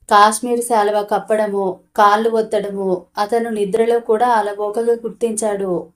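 ordinary delivery, nothing marked

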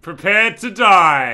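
villain threat. Pretend. Gloating villain line in duel.

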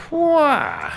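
high, loud, falling whoop; relieved whoop. Real, not acted. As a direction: let out relief in a big cry. Relief after drinking.

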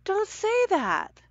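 put-on a boy begging her not to say it; Jared protests to Olga, high and slow; protesting Jared.

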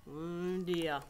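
usual speaking voice

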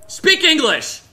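high, loud exasperated demand; frustrated shout. Real, not acted. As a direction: yell it in exasperation.

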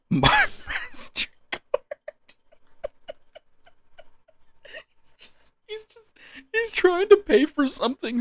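high, breaking with laughter; wheezing giggles; laughing. Real, not acted. Laughing fit over card.

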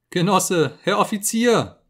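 high, loud desperate cry; terrified scream. Acted. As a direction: beg in panic, voice shooting up. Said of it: "schrie er".

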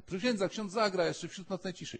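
put-on high, eager kid begging the priest; pleading child. Children nag the young cleric to play, high and pleading. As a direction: beg like an impatient child.

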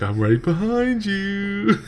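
slow, drawn-out creepy whisper of a threat; creepy quote. Retold or imitated. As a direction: stretch it out like a stalker. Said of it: "you say that with a smile on your face".

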